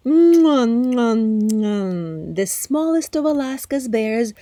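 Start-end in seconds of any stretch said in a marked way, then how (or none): 0.1-2.4 loud, high smacking kisses of delight; hungry bear smacking his lips at berries; loud lip smacks. Acted.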